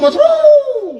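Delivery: high, loud stretched yell of mushroom; mock shouting. Mimicking the rival shouting his favourite word, high and loud. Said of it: "अरे का ओरडतो का?".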